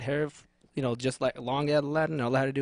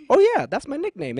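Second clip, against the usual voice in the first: improvising; 10 semitones higher, 6 dB louder than usual. Retold or imitated. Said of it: "I'm like".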